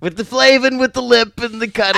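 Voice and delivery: high, loud goofball babble; Jerry Lewis. Acted. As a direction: squawk nonsense like a manic slapstick comedian. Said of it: "suddenly went all Jerry Lewis there".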